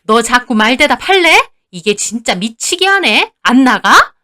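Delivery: exasperated scolding, loud at each end; angry telling-off. A narrator's reading. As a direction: drive her out of the kitchen. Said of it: "나무라면서 쫓아내는데". (화내며 쫓아내는 시어머니)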